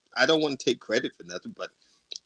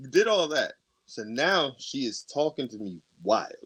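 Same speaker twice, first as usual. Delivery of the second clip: ordinary delivery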